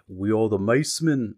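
British trigger phrase, low and measured; lightly British catchphrase; attempted British accent. Shown as a demonstration. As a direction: put on an amateur British accent. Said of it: "that's how I get into the accent".

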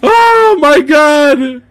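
high, drawn-out, near-crying wail; helpless with laughter. Real, not acted. Recovering from hysterical laughing fit.

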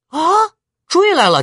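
high, loud alarmed exclamation; startled cry. Acted. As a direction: jolt up at the bad news. 刘备闻听曹兵追来. (惊呼，声高而急)

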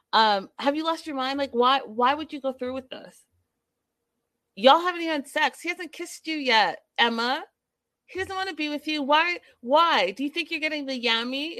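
fired-up disbelief at the TV; exasperated rant. Real, not acted. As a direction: lecture her in disbelief. Ranting at Emma on screen.

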